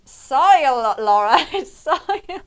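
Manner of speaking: hammed-up posh voice, stretching out soil. Friend [name] correcting Laura's word dirt, in a loud mock-posh voice. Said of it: "It does sound really posh when you say soil".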